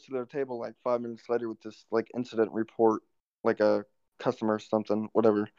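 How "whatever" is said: normal talking voice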